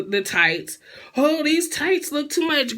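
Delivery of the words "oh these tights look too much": imitating the singer, higher and slower; mock whiny complaint. Vlogger imitating the singer fussing over tights, mock whine. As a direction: whine about the tights in a put-on voice. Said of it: "she's bitching about the tights".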